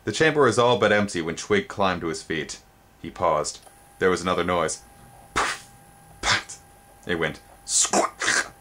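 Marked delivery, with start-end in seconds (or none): 5.3-8.5 breathy puffs and squawk; sound effects. A narrator's reading. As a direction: mimic the creature noises.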